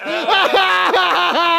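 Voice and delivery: very high-pitched cackle; high shrieking laugh. Pretend. Laughing at companion in a high shriek.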